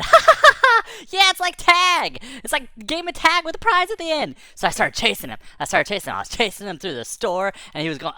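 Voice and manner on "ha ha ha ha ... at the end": high, loud, giddy delight; laughing excitement. Retold or imitated. Delighted at chase game.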